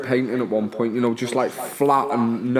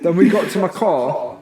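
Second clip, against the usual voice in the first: usual speaking voice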